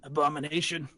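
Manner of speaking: rising high, shouting mayor; outraged mayor. Acted. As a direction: build from accusation to a high shout. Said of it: "he's just like".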